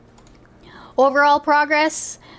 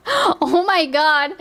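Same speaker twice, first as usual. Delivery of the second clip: horrified amusement; 6 semitones higher, slower than usual. Real, not acted. Reacting to racism claim.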